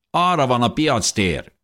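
high, harsh soldier's yell; screeched order. A narrator's reading. Soldier herds the family toward the square, screeching. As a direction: screech the direction like a command. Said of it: "rikácsolta az egyikük".

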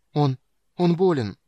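high, loud, halting words; flustered stammer. A narrator's reading. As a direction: blurt it out, stumbling in confusion. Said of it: "в замешательстве пролепетала Эмберил". (растерянно, запинаясь)

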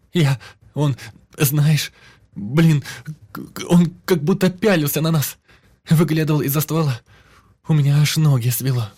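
high, loud terrified babbling; frantic shouting. A narrator's reading. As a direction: stammer it out in panic. Girl panics at hanged body.

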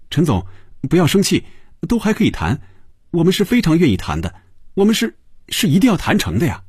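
high-pitched, hurried pleading; eager, flustered appeasing; anxious placating. A narrator's reading. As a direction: talk fast and high to calm an angry client. Tony placates the angry client.

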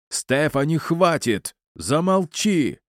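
high-pitched yell, drawn out on the last word; father's yell, held in volume. A narrator's reading. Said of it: "заорал отец так".

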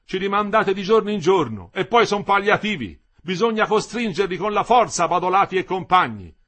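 high, loud protest; rally the crowd against the bosses; loud, insistent striker's harangue; heated agitation. A narrator's reading.

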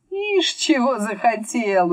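slow, high scoffing tone; amused scoff. A narrator's reading. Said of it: "усмехнулся Мурвей".

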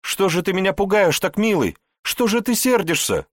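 high, loud, hurt reproach; half scold, half cry; tearful reproach. Acted. Wife reproaches her husband for scaring her, high and hurt.

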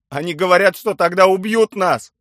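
high, very loud, desperate; frightened hostage relaying a threat; alarmed shout. A narrator's reading. Hostage interpreter in panic.

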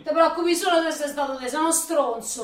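urlando forte, più acuta; insulti furiosi. Acted. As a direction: gli sputa addosso gli insulti. Lei insulta lui urlando. (lei urla a lui insulti furiosi)

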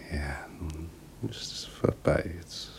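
hushed and hopeless; despairing whisper. Acted. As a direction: let it fade into loneliness. Resigned despair.

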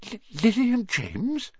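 high, stricken, stammering disbelief; gasped. A narrator's reading. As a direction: gasp out shock and grief, pitched high.

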